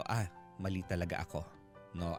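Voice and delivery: usual speaking voice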